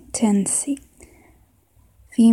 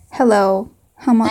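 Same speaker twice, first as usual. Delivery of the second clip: put-on southern accent; 4 dB louder than usual. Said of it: "maybe a southern accent".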